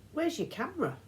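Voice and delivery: put-on high, curious boy's question. A narrator's reading. Grover asking the old woman, high and puzzled. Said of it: "Grover asked".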